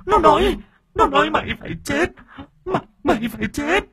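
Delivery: very high, frenzied threat; piercing, eerie death curse; possessed shriek. A narrator's reading. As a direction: screech it like one possessed. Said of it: "cô rít lên".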